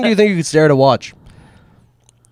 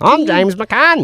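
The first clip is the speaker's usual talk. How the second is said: higher hammed-up accent voice; bad James McCann impression; 5 semitones higher, much slower than usual. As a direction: ham up an ad-man voice. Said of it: "bad of an impression".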